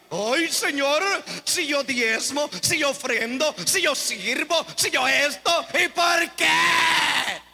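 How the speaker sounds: lamento exagerado, agudo y fuerte; queja dramática. Acted. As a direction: imita a un creyente que se queja a Dios, alargando el final en un grito. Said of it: "sean bien dramáticos. Por eso lo hago así". (reclamo teatral y burlón que sube hasta un "por qué" gritado)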